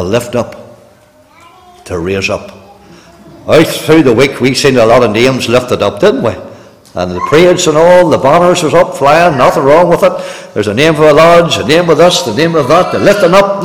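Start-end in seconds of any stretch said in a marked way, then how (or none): none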